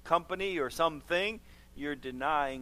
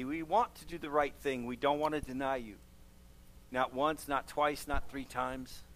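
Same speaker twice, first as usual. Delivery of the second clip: earnest prayer voice, softening toward amen; heartfelt church prayer, quieter at the close; closing prayer; 3 semitones lower, 3 dB quieter than usual. Acted. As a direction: pray over the congregation, fading gently at the end. Pastor leads closing prayer.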